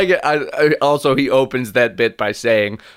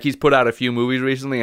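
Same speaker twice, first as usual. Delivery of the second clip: usual speaking voice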